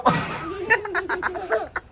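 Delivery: bright burst of laughter; real laughter; high laugh. Real, not acted. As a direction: laugh at the pair. Laughing at shared plates.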